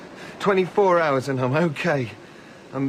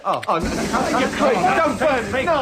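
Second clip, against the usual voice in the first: terrified begging; 5 semitones higher than usual. Acted. Begging Macready not to burn him, terrified. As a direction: beg for your life.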